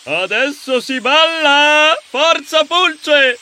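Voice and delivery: high, drawn-out hero's call; rally your partner to dance; rousing cheer. Acted. Ercole urging Pulce to dance, excited call. Ercole rallies his friend.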